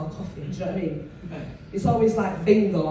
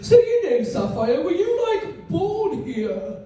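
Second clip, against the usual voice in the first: posh barbecue guest; 9 semitones higher, slower than usual. Retold or imitated. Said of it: "someone said".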